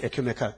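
에케메칼 repeated low and soft, falling; stressed repeat. Real, not acted. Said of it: "에케메칼 됐어". (에케메칼을 낮게 힘주어 되풀이)

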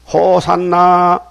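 loud, drawn-out acclamation; crying out. A narrator's reading. Children shouting in the temple to hail Jesus, crying out. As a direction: call it out slowly and loudly. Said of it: "소리질러".

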